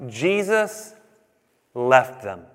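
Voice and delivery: Jesus lifted, then left them dropped low after a pause; grave emphasis. Shown as a demonstration. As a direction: let left them fall heavy and final. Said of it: "the ultimate condemnation here".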